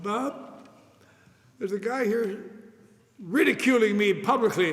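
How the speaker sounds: aggrieved call to his sponsor; indignant complaint. Retold or imitated. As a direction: tell on the guy, hurt and worked up.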